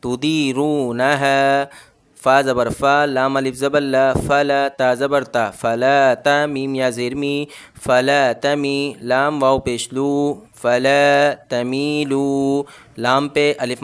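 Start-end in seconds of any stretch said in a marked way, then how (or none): 0.0-1.7 slow तू दी रू ना है with दी and रू drawn long; slow recitation; stretched दी, रू, हा. Shown as a demonstration. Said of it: "सिर्फ इसमें दाल खींचेगा". 7.8-12.6 very slow फला तमीलू, long ला, मी, लू; slow chanted reading; stretched vowels. Shown as a demonstration. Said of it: "तो ये खींचेगे".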